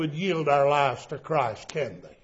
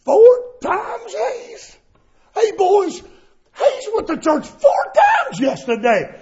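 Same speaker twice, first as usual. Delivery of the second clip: mocking coworker; 12 semitones higher than usual. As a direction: announce it to the boys to ridicule him. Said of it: "started badgering, badgering".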